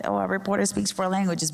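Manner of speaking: usual speaking voice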